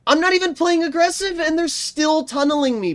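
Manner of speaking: very high, indignant outburst; mad protest. Real, not acted. Gamer targeted by the other team, high angry protest. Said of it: "I'm getting so mad".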